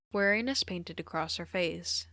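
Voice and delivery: usual speaking voice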